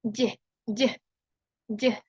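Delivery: "j" as a voiced dj like in jazz; j sound. Shown as a demonstration. Teacher showing students the j sound, voiced dj. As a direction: say "j" voiced, like the start of gym.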